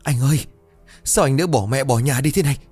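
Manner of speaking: grieving brother. A narrator's reading. Brother cries over dead brother.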